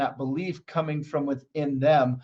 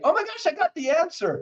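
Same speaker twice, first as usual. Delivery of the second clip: excited client; 11 semitones higher than usual. Retold or imitated. Client's excited realization quoted.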